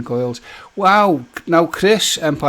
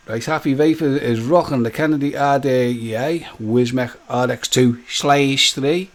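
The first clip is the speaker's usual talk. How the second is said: ordinary talk, nothing marked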